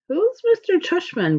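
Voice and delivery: slow, high, just-woken-up mumble; a lightly voiced sleepy sister, slow and high; groggy. A narrator's reading. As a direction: sound like you just woke up. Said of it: "Via said groggily".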